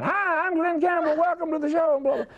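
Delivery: very high, hammed-up show host; mock your own big stage welcome; high-pitched exaggerated emcee hello; showman's stage greeting. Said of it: "standing up between three hundred people saying".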